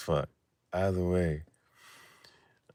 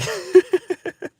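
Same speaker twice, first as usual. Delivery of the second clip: shrieking high laugh; cracking up high at his own joke; 7 dB louder than usual. Real, not acted. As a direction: let a high laugh burst out.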